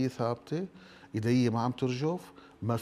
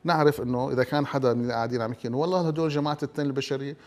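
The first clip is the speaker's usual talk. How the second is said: ordinary delivery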